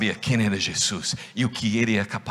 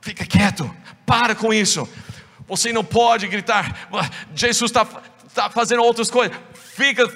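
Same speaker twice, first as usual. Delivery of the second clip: raised, louder hushing scold; scolding crowd; 10 semitones higher, 4 dB louder than usual. Acted. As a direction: shut him down firmly. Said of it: "o povo perto dele começou a falar".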